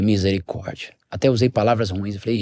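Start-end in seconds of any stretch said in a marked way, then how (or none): 0.0-0.9 soft, low, muttered "Misericórdia" in dismay. Retold or imitated. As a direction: mutter it under your breath, appalled. Sees rough skater approaching.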